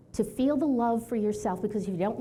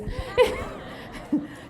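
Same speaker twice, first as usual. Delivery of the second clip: light high laugh; 14 semitones higher than usual. Real, not acted. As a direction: laugh at your own joke. Laughing at own quip.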